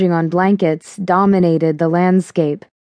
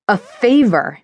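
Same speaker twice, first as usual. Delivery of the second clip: sharp incredulous yell; indignant shout of disbelief; 3 semitones higher than usual. A narrator's reading. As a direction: throw the word back at him, yelling. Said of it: "I heard myself yelling".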